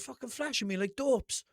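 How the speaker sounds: high-pitched indignant retort; retold annoyance. Driver answering the guard about the lights, annoyed retort. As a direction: answer back, pitch jumping up. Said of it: "So I got pure annoyed then".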